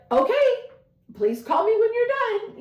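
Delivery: mock mother, high and anxious; imitating mom. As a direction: pitch up to play the worried mother. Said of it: "She's like".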